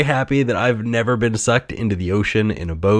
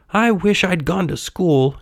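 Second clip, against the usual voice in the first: high-pitched puppet voice, rueful; regretful puppet; 11 semitones higher than usual. A narrator's reading.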